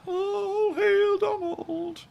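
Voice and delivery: very high, slow squawking duck; Donald Duck voice. Pretend. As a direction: stretch it out in a cartoon duck squawk. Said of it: "Donald Duck".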